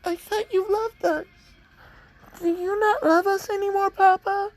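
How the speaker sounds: put-on very high, tearful little-girl voice, gasping and crying; a small child sobbing to her papa; fake Eri as a crying little girl. Fake Eri cries to Papa as he hurts them, in a high sobbing child voice. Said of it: "fake Eri's just like".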